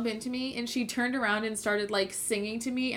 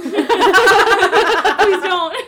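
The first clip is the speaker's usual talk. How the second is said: laughing; 9 semitones higher, 16 dB louder than usual. Retold or imitated. Retelling mom's joke, laughing.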